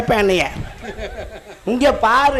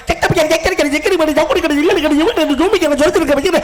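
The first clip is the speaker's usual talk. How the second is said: mocking singsong recital; 3 semitones higher than usual. Man mimics child's memorised tirade, mocking singsong. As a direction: imitate someone rattling off a memorised song. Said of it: "மனப்பாடம் பண்ணி பாட்டு பாடின மாதிரி".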